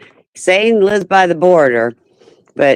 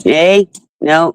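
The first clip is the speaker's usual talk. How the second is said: ordinary talk, nothing marked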